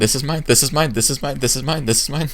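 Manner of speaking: high, rapid-fire repeated claims; greedy claiming. Acted. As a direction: rattle it off as if grabbing everything. Mimics her claiming everything in sight.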